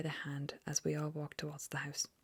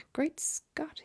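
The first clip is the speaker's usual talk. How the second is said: much higher startled exclamation; astonished gasp; 11 semitones higher, 3 dB louder than usual. Acted. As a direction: caught completely off guard. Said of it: "he gasped".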